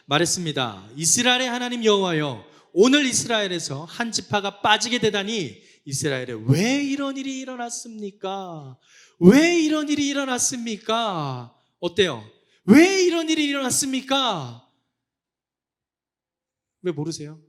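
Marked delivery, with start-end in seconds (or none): 1.0-11.5 wailing protest. Acted. Israelites lamenting to God over a lost tribe, wailing protest. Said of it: "그 통곡의 내용이 뭘까요?". 12.7-14.7 loud, high cry of complaint; wailing protest. Acted. Israelites demanding an answer from God, loud wailing protest. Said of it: "그 통곡의 내용이 뭘까요?".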